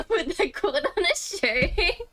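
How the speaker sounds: loud, high, laughing voice. Real, not acted. Streamer tickled by the playgirl quote, laughing.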